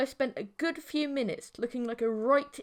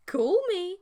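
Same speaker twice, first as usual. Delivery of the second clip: high playful call me, higher and louder; teasing; 9 semitones higher, 6 dB louder than usual. A narrator's reading. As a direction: tease him into calling. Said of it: "she teased".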